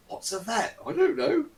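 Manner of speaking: very high, puzzled voice; a silly high voice not knowing the word; confused bystander. Acted. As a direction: bystander asking what a vet is, puzzled. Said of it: "They're like".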